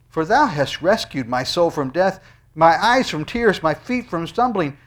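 loud, high scripture proclaimed; preached scripture reading. Acted. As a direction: proclaim the psalm at full force. Reads psalm at full force.

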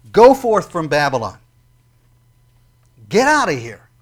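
loud, ringing command; commanding proclamation. Acted. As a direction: order them out with authority. Reading God's command.

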